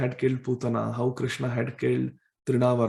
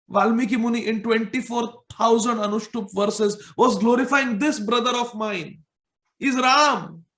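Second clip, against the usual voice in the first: proud proclamation; 7 semitones higher than usual. Acted. Balram boasts to Yashoda of his brother's avatars, high and proud. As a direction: proclaim each avatar name triumphantly.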